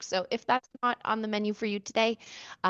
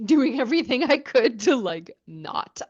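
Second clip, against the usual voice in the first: talking through a real laugh, louder; 4 semitones higher, 7 dB louder than usual. Real, not acted. Podcaster laughing about fighting back her tears, laughing. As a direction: laugh it off while explaining.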